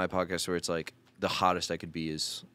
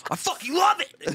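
high squealing delight; excited laughing shout; 5 dB louder than usual. Real, not acted. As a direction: shriek it through laughter. Laughing burst.

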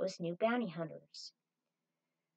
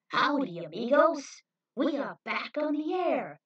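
loud showman greeting; 5 semitones higher, 8 dB louder than usual. Acted. TV hosts greet their audience.

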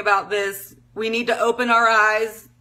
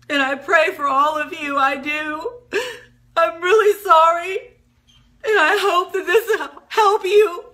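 real tears, higher, voice breaking; crying; 5 semitones higher than usual. Real, not acted. Vlogger praying for Palestinians through real tears. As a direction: cry through the prayer. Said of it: "I've been crying all week".

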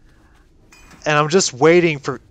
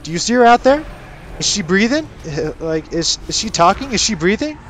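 high, loud mock disbelief; mock-indignant father asking if she's breathing; father's incredulous retort; 7 semitones higher, 4 dB louder than usual. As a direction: toss the questions back, pitched way up. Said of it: "his response was like".

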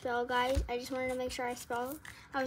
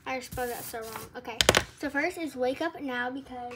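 usual speaking voice

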